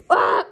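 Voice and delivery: short, loud acted scream; startled yell. Contestant in a scary mansion, short startled scream. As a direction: yelp out suddenly.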